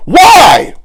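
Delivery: sudden high yelled why; angry shout. Real, not acted. Furious creator yelling at the mother. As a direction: explode at her with one word.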